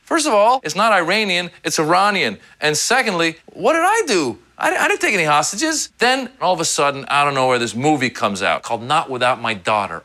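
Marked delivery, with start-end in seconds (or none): 0.0-5.9 put-on high, exasperated kid voice; indignant kid protest. Kid shouting back at sixth graders, exasperated. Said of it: "I was like".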